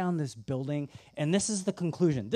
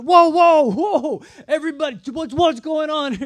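loud, high calls of whoa to break up a fight; alarmed shout; 10 semitones higher, 11 dB louder than usual. Retold or imitated. Breaking up two men fighting, loud alarmed shout. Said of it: "And I was like".

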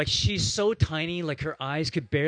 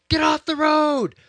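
shrill, much higher, loud shout at a cyclist; angry driver's yell; 14 semitones higher, 11 dB louder than usual. Retold or imitated. Driver shouting at a cyclist in the way, angry yell. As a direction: yell it out the car window at him. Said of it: "this woman's voice go".

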